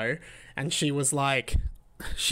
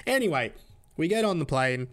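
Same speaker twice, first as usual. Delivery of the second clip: ordinary talk, nothing marked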